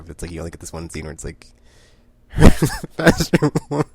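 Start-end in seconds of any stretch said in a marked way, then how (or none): 2.3-3.9 loud, high quote bursting with laughter; laughing quote. Retold or imitated. Fan quoting Prince Robot's bossy line, laughing. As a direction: crack up repeating the line. Repeats favourite line, cracking up.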